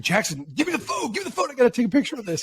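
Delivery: high, eager retold plea for the phone; mock excited demand. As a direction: pitch it way up like a kid who must have the picture. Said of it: "I was like".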